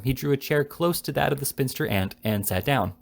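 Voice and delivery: plain reading voice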